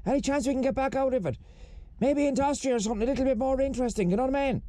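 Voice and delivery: high-voiced complainer wanting out; bored Billy. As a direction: grumble in a high, fast patter. Plays Billy in the skit.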